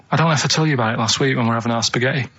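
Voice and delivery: normal talking voice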